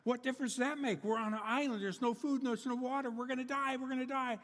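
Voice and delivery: high, fast, panicked outburst; frantic disbelief. Acted. Dumbfounded castaway shouting panic at his calm friend. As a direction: rattle it off in terror. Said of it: "quite dumbfounded and asked".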